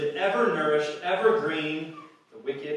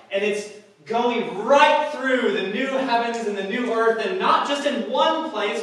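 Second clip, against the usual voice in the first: rising, louder preacher's build, slowing on the last words; preaching at full force; 8 semitones higher, 11 dB louder than usual. Acted. Preacher to congregation about the river of life, full force. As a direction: swell it like a pulpit climax.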